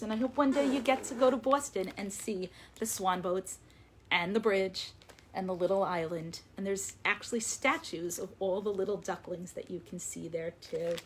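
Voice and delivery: usual speaking voice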